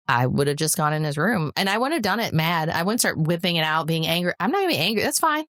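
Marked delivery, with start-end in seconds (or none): 4.4-5.4 breezy unconcern. Retold or imitated. Acts out unbothered reply to refusal.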